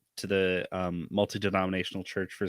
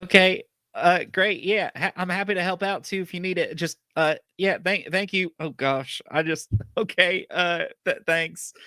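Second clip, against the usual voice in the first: put-on flustered farm boy; 7 semitones higher than usual.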